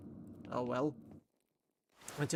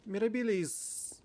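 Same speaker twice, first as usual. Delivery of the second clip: gasp, then a high, slow, delighted cry; 8 semitones higher, slower than usual. Real, not acted. Player spots the dog and cries out in delight. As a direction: caught off guard by something lovely.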